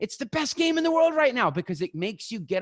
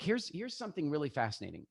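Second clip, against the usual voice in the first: ordinary talk, nothing marked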